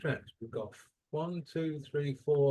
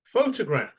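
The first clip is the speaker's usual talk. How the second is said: photograph, loud high first syllable; photograph with the opening syllable strongest; stress on first syllable; 5 semitones higher, 8 dB louder than usual. Shown as a demonstration. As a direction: punch the PHO in photograph. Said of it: "It's the first syllable".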